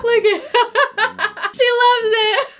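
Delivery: high squeal breaking into giggles; laughing delight. Real, not acted. Watching the dog love the plush, laughing squeal.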